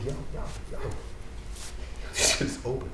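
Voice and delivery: hushed whisper to friends; sneaky whispered tip-off. Retold or imitated. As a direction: whisper it so nobody hears. Whispering to friends at open machine.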